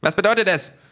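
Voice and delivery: high and loud impatient question; loud demand. Acted. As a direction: demand the answer. Little thug demanding loudly.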